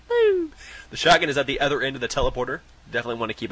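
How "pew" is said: high, loud laser-like pew; game jump sound. Retold or imitated. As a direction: chirp a quick high pew. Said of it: "I go".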